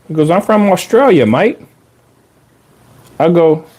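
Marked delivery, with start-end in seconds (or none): 0.3-1.6 imitated Australian accent, pitched up at first; British Australian accent. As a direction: mimic an Aussie introducing himself. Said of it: "Had, like, a British Australian accent".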